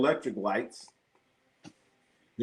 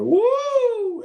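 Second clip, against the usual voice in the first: high whoop; 4 dB louder than usual. Real, not acted. Reacting to LA water.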